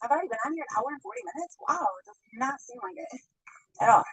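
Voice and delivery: ordinary talk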